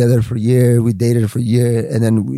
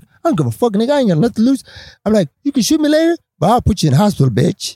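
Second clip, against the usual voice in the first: defiant threat; 7 semitones higher, faster than usual. Retold or imitated. Threatening the bosses.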